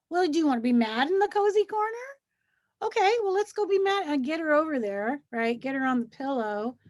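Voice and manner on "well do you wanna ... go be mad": coaxing teacher. Retold or imitated. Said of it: "And then I'll go".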